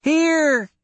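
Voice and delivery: put-on very high, loud child's cry; a frightened girl shrieks her answer; little girl screaming. A narrator's reading. Little girl screaming back to Royce from the trees. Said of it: "she screamed".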